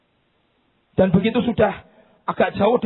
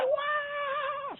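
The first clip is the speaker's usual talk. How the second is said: high crying yell of a mother; mock scream; 12 dB quieter than usual. Mother screams as her child walks, imitated scream. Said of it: "Mamanya teriak".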